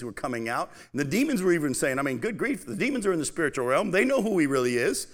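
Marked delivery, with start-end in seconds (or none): none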